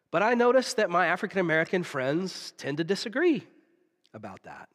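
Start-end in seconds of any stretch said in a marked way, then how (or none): 4.1-4.7 conspiratorial whisper; whispered aside. Real, not acted. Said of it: "aside whispered to congregation".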